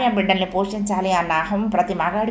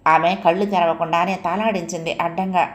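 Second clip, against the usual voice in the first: ordinary delivery, nothing marked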